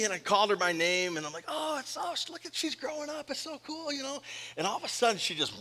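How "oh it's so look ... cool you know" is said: much higher, soft cooing voice; baby talk. Retold or imitated. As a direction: coo like talking to a baby.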